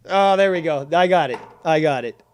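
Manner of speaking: loud, excited gotcha; loud gleeful exclamation; triumphant. Real, not acted. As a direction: crow over the capture. Catching a clip triumphantly.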